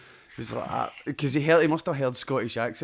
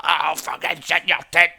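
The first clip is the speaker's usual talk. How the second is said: higher, much louder mock rage; retold raging; 5 semitones higher, 9 dB louder than usual. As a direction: rant at him as if furious. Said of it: "genuinely raging".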